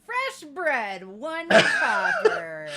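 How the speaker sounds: loud, slow, high vendor cry; hawker call. Pretend. Girl selling bread to the crowd, loud drawn-out call. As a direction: stretch out the price for the crowd.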